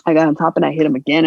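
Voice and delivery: normal talking voice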